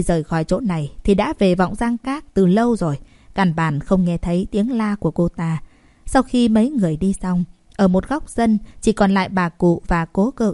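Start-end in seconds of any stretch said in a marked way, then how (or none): none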